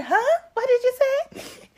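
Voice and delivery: high, loud incredulous question; shocked huh. Shown as a demonstration. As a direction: replay the confused little girl for the host. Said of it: "Can you do that once again?".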